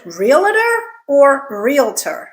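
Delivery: realtor as REE-luh-ter, then REEL-ter; contrasting pronunciations. Shown as a demonstration. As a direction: say realtor with three syllables, then two. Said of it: "There are only two syllables in this word".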